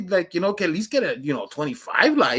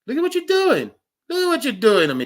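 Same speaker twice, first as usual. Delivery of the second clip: high, whiny mock accusation; mock outrage; 8 semitones higher than usual. Teasing the chat, mock whiny outrage. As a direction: pretend to be wronged by the chat.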